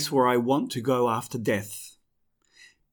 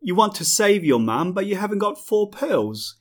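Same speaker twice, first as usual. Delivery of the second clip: higher, smiling taunt; smiling mockery; 6 semitones higher, 4 dB louder than usual. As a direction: gloat with a smile. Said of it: "he smiles".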